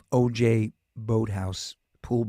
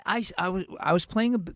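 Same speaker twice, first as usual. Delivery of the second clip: ordinary talk, nothing marked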